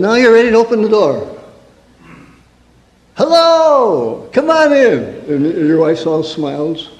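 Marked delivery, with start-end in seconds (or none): 3.1-5.0 high sugary host greeting; overly sweet, put-on hospitality; fake cheerful welcome.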